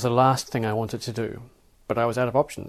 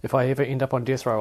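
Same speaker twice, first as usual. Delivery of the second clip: ordinary delivery, nothing marked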